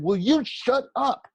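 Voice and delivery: loud, high, slow outburst; blurted shout. A narrator's reading. Boy turning on a classmate, shouting. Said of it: "It came out louder than I meant it to".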